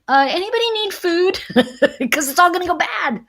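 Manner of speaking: a hoarder meekly offering leftovers; sheepish neighbour. Retold or imitated. Hoarding neighbours with spoiled food.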